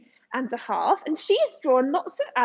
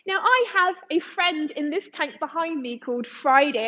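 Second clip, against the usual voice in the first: ordinary talk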